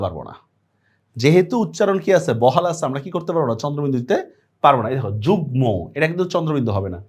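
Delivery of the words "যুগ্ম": যুগ্ম as "jugmo"; m fully pronounced. Shown as a demonstration. Teacher shows the exception, যুগ্ম with its m sounded. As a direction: keep the m audible in যুগ্ম. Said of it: "উচ্চারণটা বহাল থাকে".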